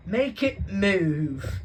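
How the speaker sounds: put-on high, slow, drawn-out whine of a spoilt kid; whiny spoilt boy. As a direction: whine it out like a bratty son. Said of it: "he whined at his father".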